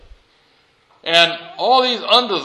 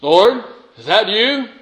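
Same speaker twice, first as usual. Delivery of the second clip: higher, louder, slow call upward; 5 semitones higher, 4 dB louder than usual. Acted. Man hanging from the branch calls up, louder. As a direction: call up, unsure who answers.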